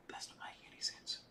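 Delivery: breathy whisper under her breath; whisper to self. Real, not acted. Host whispers to herself while hunting through her app, whispered. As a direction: whisper it to yourself, puzzled.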